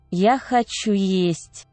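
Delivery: normal talking voice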